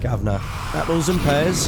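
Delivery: mock Cockney geezer greeting the chat; Cockney accent. Streamer greets his viewers in a put-on Cockney accent. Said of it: "It's Cockney slang".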